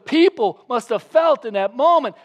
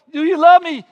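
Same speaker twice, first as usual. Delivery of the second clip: higher, louder repeated question; Jesus asking again; 8 semitones higher, 10 dB louder than usual. Retold or imitated. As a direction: press the question. Jesus asks third time.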